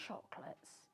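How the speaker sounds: usual speaking voice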